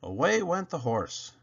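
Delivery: usual speaking voice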